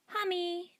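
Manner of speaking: put-on high toddler shouting; toddler cry. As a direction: call it like a little child. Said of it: "Derek cried".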